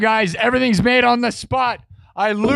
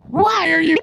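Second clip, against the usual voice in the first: mock crying; 7 semitones higher than usual. Streamer teasing the crying poll option, mock wail.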